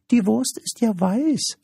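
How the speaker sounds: very high-pitched creature voice; high, complaining little creature; cheeky little creature. Acted. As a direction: squeak it like an impish little creature.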